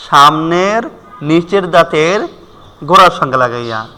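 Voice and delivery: ordinary delivery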